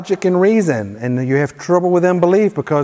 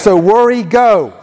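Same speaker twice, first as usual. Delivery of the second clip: higher, louder commanding declaration; like you mean it; 4 semitones higher, 4 dB louder than usual. Shown as a demonstration. Preacher banishes worry and fear for church to repeat, loudly commanding. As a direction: banish it in your outside voice. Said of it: "say it like you mean it".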